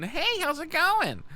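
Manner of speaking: high, slow, thin squeaky voice. Shown as a demonstration. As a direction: pitch way up into a squeak. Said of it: "I still sound super fucking".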